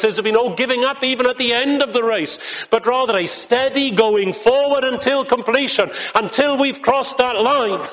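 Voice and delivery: rising, fervent pulpit delivery; preaching at full force. Acted. Preacher rousing the congregation about the spiritual battle, fervent. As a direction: build it like a call to battle.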